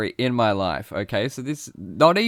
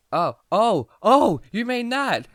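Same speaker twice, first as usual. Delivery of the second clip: mock realisation; 8 semitones higher, 4 dB louder, slower than usual. Realising his mistake.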